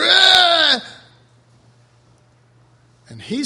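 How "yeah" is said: loud, high mock roar, shouted out; lion roar. Preacher mocking the lion people expect, loud roar. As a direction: let the big lion roar. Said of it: "We're looking for".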